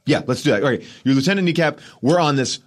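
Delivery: high, rushed, laughing yes-yes-yes; gushing director. Retold or imitated. Director hyping the actor with high gushing praise. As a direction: oversell it like he loves everything. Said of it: "So he's like".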